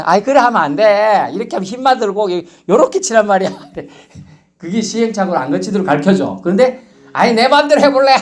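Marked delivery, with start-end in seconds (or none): 0.0-3.5 scolding elder. Retold or imitated. 7.1-8.1 defiant beginner. Retold or imitated. Stubborn beginner talking back.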